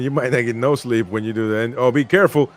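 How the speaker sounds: ordinary talk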